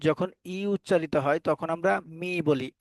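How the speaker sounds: ordinary talk, nothing marked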